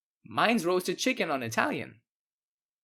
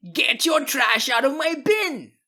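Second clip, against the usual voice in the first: much higher, louder, slow enraged shout; furious yell; 9 semitones higher, 5 dB louder than usual. Acted. Homeowner yelling at teen about his bin, enraged. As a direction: scream over a soda can. Neighbor yells about the can.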